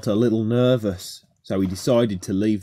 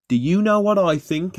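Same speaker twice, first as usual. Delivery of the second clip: mildly annoyed, pitched higher; a touch of indignation on a raised pitch; slight annoyance; 9 semitones higher, 3 dB louder than usual. A narrator's reading. Rheneas answers the friends who tricked him, slight annoyance. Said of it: "with a slight tone of annoyance".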